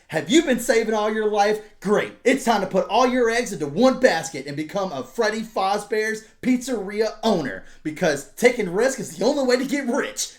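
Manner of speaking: high, loud pushy salesman pitch; mocking a hyped-up pyramid-scheme ad; cheesy ad voice. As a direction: sell it like a smarmy infomercial host. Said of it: "it's like an ad that's talking to you".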